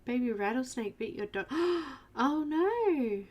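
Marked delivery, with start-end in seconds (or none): 1.5-2.1 sharp high gasp of shock. Real, not acted. Streamer gasps at chat's news about a dog bite, shocked. 2.1-3.2 high, louder dismayed oh no; shocked oh no. Real, not acted. Streamer reacts to viewer's dog bitten by snake, dismayed.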